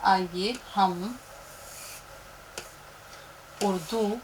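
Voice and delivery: slow, drawn-out dictation of the title; teacher writing on the board, reading aloud; slow teacher's dictation. Shown as a demonstration. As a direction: read it out slowly as you write. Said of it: "कलम पर ध्यान दीजिएगा".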